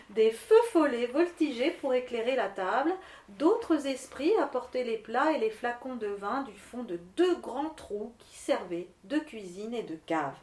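ordinary delivery

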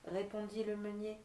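plain reading voice

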